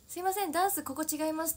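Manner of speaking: earnest tone. Retold or imitated. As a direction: deliver it with serious rehearsal intensity. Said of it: "っていうテンションで".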